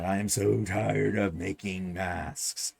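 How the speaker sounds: slow, dragged-out weary groaning delivery; low, worn-out whine; fed-up weary complaint. Acted. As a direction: drag it out like you're sick of the chore. Acts out fed-up mask makers.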